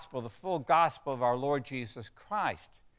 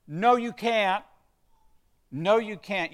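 higher, louder firm denial; emphatic rebuttal; 7 semitones higher, 6 dB louder than usual. Real, not acted. Preacher rebuts people who say you can jump out, emphatically. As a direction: shut the idea down firmly.

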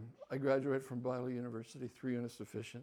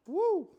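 high, loud whoop; 9 dB louder than usual. Real, not acted. Reacting to the prophet's warning with a loud whoop. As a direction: let out a stunned whoop.

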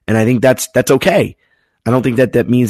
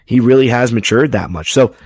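usual speaking voice

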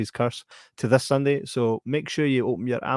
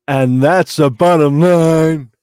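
slow, booming wrestler catchphrase; wrestling promo; 3 semitones higher, 9 dB louder, much slower than usual. Retold or imitated. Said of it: "some wrestling stuff there".

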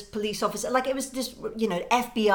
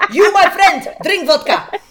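booming, much louder, higher mock thug voice; mafia heavy; 9 semitones higher, 15 dB louder than usual. Mafia meathead ordering the tiny guest to drink, booming mock thug. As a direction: bellow it like a pushy Latvian mafia heavy. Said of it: "I'd put their arm around him and I'd go".

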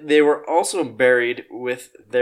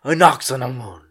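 mock Egyptian word; 4 semitones lower than usual. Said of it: "saying some Egyptian words like".

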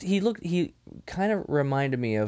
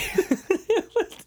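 high giggling laugh. Real, not acted. Laughing at the Kano quote.

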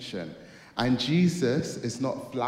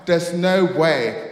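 loud, slow, raised-pitch disbelief; shocked disbelief; 5 semitones higher, 8 dB louder, slower than usual. Retold or imitated. Youth refusing to believe his mate's news, shocked. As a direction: draw it out in acted shock. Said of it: "I was so shocked".

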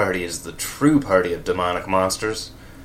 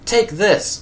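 much higher, loud attack yell; battle cry; 12 semitones higher, 5 dB louder than usual. A narrator's reading. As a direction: yell it as you strike. Hero attacks villain.

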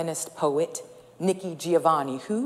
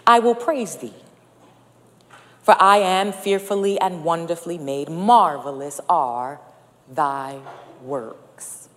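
scripture reading; 4 dB louder than usual. Acted. Opening scripture reading in sermon.

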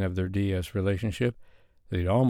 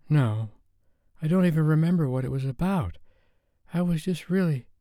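put-on high, shaken young woman's voice; a frightened woman just woken, voice higher; shaken Sophie; 8 semitones higher than usual. A narrator's reading. Sophie telling Dave after a nightmare, high and shaken.

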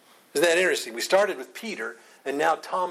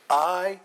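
"I" held, higher and louder; stress on I; 5 semitones higher, 4 dB louder than usual. Shown as a demonstration. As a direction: put firm weight on "I". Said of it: "Strongly emphasize".

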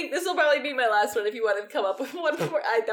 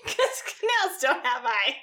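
slow repeat through laughter; amused, laugh-filled echo; 3 semitones higher than usual. Real, not acted. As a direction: repeat it slowly, still laughing. Listener repeats the line, laughing.

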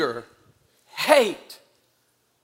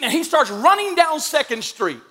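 normal talking voice

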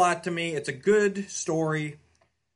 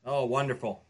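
ordinary talk

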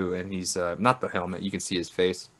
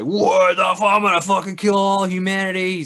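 higher, louder, hammed-up killer robot rage; furious robot; 10 semitones higher, 6 dB louder than usual. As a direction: play the robot vowing to wipe out mankind. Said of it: "Gort is just like".

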